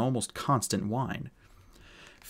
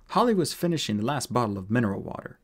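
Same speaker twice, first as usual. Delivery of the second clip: plain reading voice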